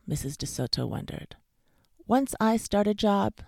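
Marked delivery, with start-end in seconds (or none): 2.1-3.4 high, loud resolve; firm. A narrator's reading. Dentist to his worried wife, firm. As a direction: state it with firm insistence. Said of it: "said the dentist firmly".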